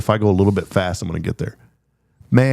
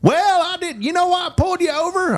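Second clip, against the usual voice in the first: Roscoe the cop. Retold or imitated. Texas cop greeting the speeding driver, in a very high voice. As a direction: squeak it out like an old small-town sheriff. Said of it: "He sounded just like that".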